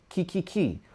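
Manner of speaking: soft repeated ki; ki syllables. Shown as a demonstration. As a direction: ki ki ki, back of tongue. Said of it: "The same as T T T".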